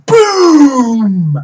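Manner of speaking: high, long drawn-out boom falling away; drawn-out, playful holler; booming shout. Pretend. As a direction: belt out the word loud and high, stretched. Booms out a game title.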